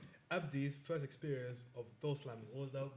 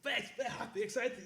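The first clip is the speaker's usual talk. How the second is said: hyped-up excitement; 9 semitones higher, 4 dB louder, slower than usual. Retold or imitated. Abdi hyped before first door.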